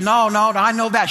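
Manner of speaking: high, loud retold objection; insistent protest. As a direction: imitate a mama cutting in to argue. Retold mama insists, arguing back.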